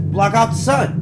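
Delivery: climbing high and loud on the pitch; excited pitch. Retold or imitated. Narrator voicing people pitching a volcano nuke, high loud excitement. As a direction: sell the big idea.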